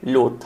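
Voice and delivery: "लोथ" low and soft, with a long o; pronunciation demo. Shown as a demonstration. Teacher showing students how to say the word, low pronunciation demo of "लोथ". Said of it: "इसका pronunciation कुछ ऐसा होता है".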